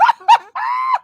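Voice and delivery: giggle, very high and loud; high shrieking giggle. Real, not acted. Recalling giggling while high, shrieking laugh. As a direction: burst into a squealing laugh.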